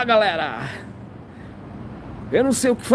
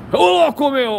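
excited exclamation; 6 semitones higher, 5 dB louder than usual. Real, not acted. Exclamation at hyped drivers.

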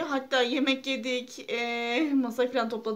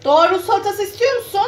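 raised voice calling to a family member in another room; 9 semitones higher, 14 dB louder than usual. Real, not acted. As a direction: call out loud across the house. (Doğa'ya başka odadan yüksek sesle seslenme)

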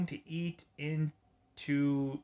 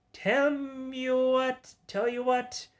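high villain voice; 10 semitones higher than usual. Acted. Voicing villain Joker style.

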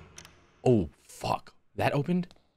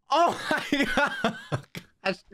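slow, high laughing half crying; tearful laugh after the scare; shaken laughter; 7 semitones higher, much slower than usual. Real, not acted. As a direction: laugh off the fright.

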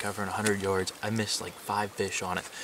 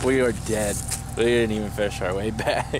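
laughing; 7 dB louder than usual. Real, not acted. Exhausted laughing after all-nighter.

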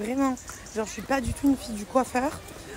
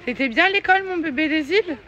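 high, louder cooing question; 6 semitones higher, 3 dB louder than usual. Real, not acted. Mother asks toddler about school.

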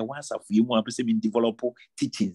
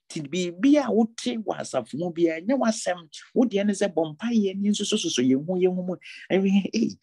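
fervent sermon exhortation; full-force preaching; 6 semitones higher than usual. Acted. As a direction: drive the warning home from the pulpit. Pastor exhorting church board members.

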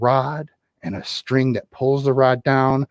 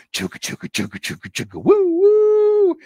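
train sound; 3 dB louder than usual. Acted. Feeding kitty as train.

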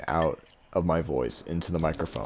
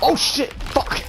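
startled swear; much slower than usual. Real, not acted. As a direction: caught off guard. Surprised by attack.

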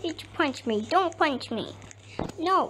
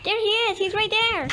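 high, excited shout-out; excited spotting call; 6 semitones higher, faster than usual. Pretend. Player spots who they are chasing, excited call. Spots the one being chased.

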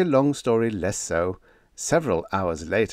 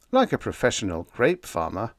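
ordinary delivery, nothing marked

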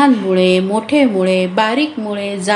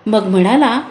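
plain reading voice

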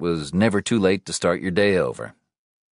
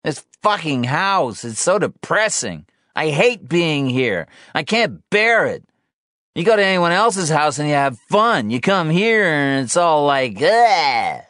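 Sean ranting; 11 semitones higher, 5 dB louder than usual. Acted. Drunk Sean complains about home, aggrieved and loud. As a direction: whine and rant, building to a loud groan.

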